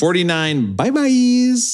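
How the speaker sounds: high, slow, drawn-out bye-byes; goofy catchphrase voice; silly sing-song. Pretend. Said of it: "That's how I'm gonna say it every time".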